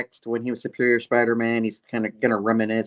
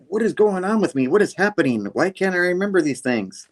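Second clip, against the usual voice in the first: higher-pitched, hammed-up anguish; distraught villain; 8 semitones higher than usual. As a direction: agonise theatrically over a failing memory. Villain despairs at mirror.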